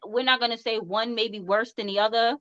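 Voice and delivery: usual speaking voice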